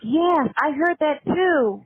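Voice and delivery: slow, high, loud mock agreement; mocking the minions. Mimicking the online followers who echo the lie, mock agreement. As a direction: ham up a gullible yes-man. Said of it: "the minions that just".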